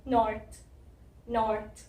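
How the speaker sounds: 'नॉर्थ' with a light, not full, r; soft R demo. Shown as a demonstration. Teacher shows the soft letter R, careful 'नॉर्थ'. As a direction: soften the r in 'नॉर्थ', end on 'th'. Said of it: "इसकी soft sound है".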